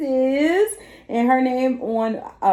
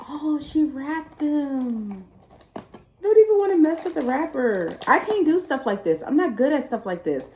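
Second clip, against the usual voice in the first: usual speaking voice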